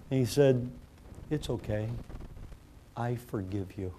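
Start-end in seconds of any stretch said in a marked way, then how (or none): 1.3-3.9 quiet, low and tender; an eight-year-old softly forgiving the principal; soft forgiveness. Retold or imitated. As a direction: forgive him gently, almost whispered.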